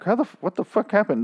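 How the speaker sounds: high, startled disbelief; baffled exclamation. Retold or imitated. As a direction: blurt it out in confusion. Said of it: "And I'm like".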